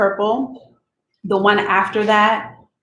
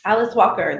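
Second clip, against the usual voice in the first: plain reading voice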